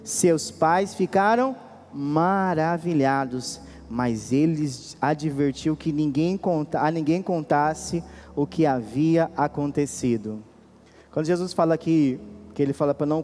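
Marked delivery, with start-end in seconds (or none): 0.0-10.4 slow, measured scripture reading. Acted. Preacher reading the girl's raising to the congregation, slow and solemn. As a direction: read the verses out deliberately, with weighty pauses.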